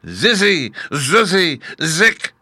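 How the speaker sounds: high, loud witch's incantation; loud cry. A narrator's reading. Witch on both feet finishing the charm, loud cry. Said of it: "cried in a loud voice".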